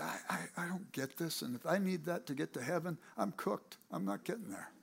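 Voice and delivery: soft, defeated murmur to yourself; despairing mutter. Retold or imitated. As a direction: say it under your breath, giving up. Despairing boy muttering to himself.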